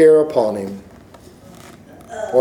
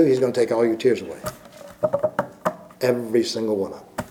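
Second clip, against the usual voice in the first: tender hush; 4 semitones lower, 9 dB quieter, faster than usual. Acted. Tender close about tears.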